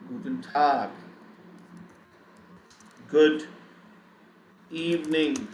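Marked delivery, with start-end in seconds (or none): none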